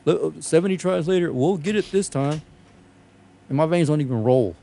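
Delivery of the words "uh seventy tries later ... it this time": higher-pitched mock nurse; mocked inept nurse. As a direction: imitate a clueless nurse making excuses. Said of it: "those fucktard nurses".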